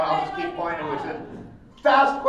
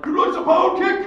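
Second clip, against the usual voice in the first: high, loud garbled battle shout; game fighter's cry; 9 dB louder than usual. Acted. Mimicking how the kick call sounds, high shout. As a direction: yell it like a special-move call. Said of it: "It sounds like".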